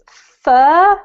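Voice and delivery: "fur" drawn out slowly and loudly, no R; slow teacher's demo of "fur"; model pronunciation. Shown as a demonstration. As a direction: model "fur" clearly for students to repeat. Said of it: "I'm gonna read out the top line".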